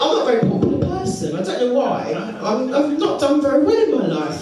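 normal talking voice

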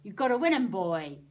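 loud blunt demand; gruff stallholder. Acted. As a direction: push it hard on the boy. Stallholder insists loudly.

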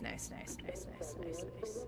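hushed whispered nice nice; breathy whisper, barely there. Real, not acted. Sneaking, repeating nice.

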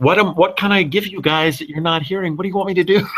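imitating a fed-up actor, pitch climbing; mock exasperation at the producers; exasperated plea. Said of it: "there's times you just wanna punch somebody".

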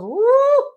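loud, high drawn-out "woo"; high whoop. Real, not acted. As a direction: let out a big rising whoop. Exclaims at recalling Sunday's show.

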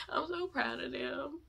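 soft, breathy whisper of pride; emotional whisper. Real, not acted. Fan moved to tears by her idols, whisper. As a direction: whisper it, overcome.

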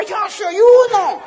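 very high, loud, drawn-out cry; mock crowd shout. Excited crowd answering the diamond, long high mock scream. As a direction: scream it like a convention fanatic. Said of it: "la gente levantaba los pies y las manos y decía".

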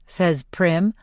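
usual speaking voice

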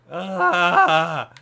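sudden loud yell; frustrated yell. Real, not acted. Stuck in trash bin.

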